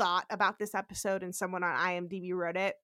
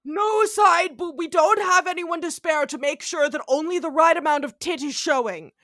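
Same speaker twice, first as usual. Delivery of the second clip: mock network censor; 6 semitones higher, 7 dB louder than usual. NBC censors banning side boob, high loud mock prissiness. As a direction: play a prissy exec laying down rules. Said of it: "N B C was like".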